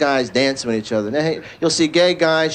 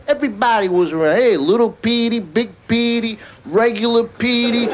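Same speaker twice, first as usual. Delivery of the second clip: higher-pitched South Philly Italian street voice; South Philly corner guy rattling off nicknames; South Philly accent; 4 semitones higher than usual. Acted. Kid's memory of corner guys, South Philly accent. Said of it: "I'd see the guys in the corner".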